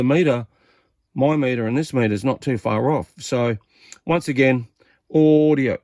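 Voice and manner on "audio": drawn-out audio check into the mic; radio test call. Real, not acted. Testing his CB transmit, drawn-out radio check call. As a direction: call it out slow like keying a CB.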